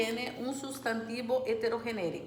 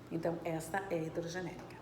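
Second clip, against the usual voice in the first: whispered aside; 5 semitones lower, 8 dB quieter, much faster than usual. Real, not acted. Teacher's quick aside answer.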